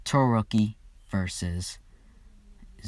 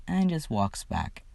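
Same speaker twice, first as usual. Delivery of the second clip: normal talking voice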